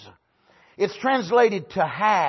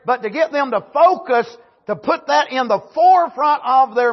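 fiery preaching, raised and loud; 6 semitones higher, 5 dB louder than usual. Real, not acted. Preacher pressing the church on the resurrection, full force. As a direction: preach it hard to the congregation.

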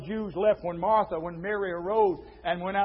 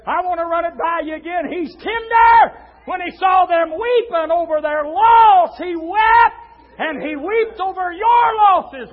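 high, shouted pulpit delivery; full-force preaching; 8 semitones higher, 10 dB louder, slower than usual. Real, not acted. Preacher tells flock he weeps over their losses, full-force preaching. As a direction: cry it out to the whole congregation.

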